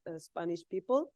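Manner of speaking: usual speaking voice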